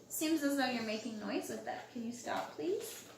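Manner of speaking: usual speaking voice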